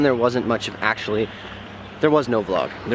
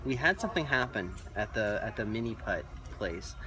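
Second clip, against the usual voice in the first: very soft, discreet voice; hushed aside; 4 semitones lower, 14 dB quieter than usual. Real, not acted. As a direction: confide it quietly to the camera. Confiding in shop, not here.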